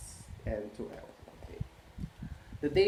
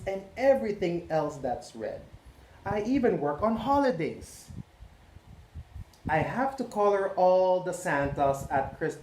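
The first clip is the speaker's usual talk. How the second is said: higher, put-upon voice of a tired crayon; overworked red crayon; 6 semitones higher than usual. Acted. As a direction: complain like a worn-out crayon who needs a break. Red Crayon's letter read aloud.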